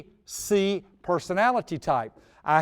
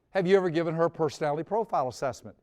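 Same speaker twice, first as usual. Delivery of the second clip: fast, run-together question; rapid-fire question; much faster than usual. Retold or imitated. Friend to worried father, fast rattled question. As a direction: rattle it off quickly. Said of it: "He said that so quickly".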